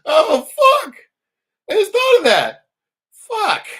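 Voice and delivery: loud, high yelp of realization; shouted self-curse; sudden shout. Real, not acted. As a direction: blurt it out at full volume, startled.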